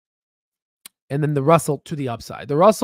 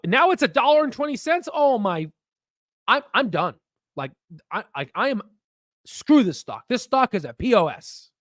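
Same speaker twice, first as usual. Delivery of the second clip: frustrated outburst; 6 semitones higher than usual. Retold or imitated. Acted frustrated holder.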